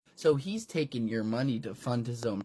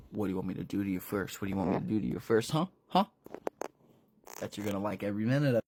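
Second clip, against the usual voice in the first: rapid low taunting; 5 semitones lower, much faster than usual. Acted. Villain taunting fast.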